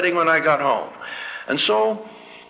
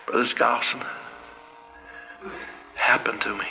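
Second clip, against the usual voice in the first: soft, lower, hushed confiding; shy confession; 7 semitones lower, 8 dB quieter than usual. Retold or imitated. Big football player embarrassed, quietly confiding in his teacher. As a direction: hold the words back shyly, low and soft.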